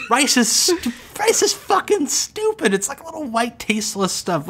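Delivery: mock outrage, pitched high; a hammed-up cuss-out of a listener; mock rant. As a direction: rant like a pretend angry roaster. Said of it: "clear my throat".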